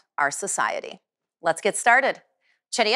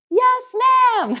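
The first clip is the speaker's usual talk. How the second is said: high loud shout; shouted yes ma'am; 5 dB louder than usual. Shown as a demonstration. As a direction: answer with full energy like a cadet. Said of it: "Remember what you say".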